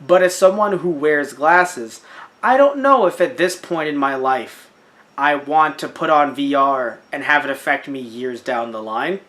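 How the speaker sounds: ordinary talk, nothing marked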